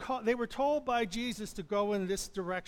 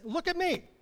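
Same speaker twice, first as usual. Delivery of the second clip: higher Jesus voice; 9 semitones higher than usual. Acted. Preacher voicing Jesus calling the fearful disciples, much higher. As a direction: lift it up into a higher gentle call.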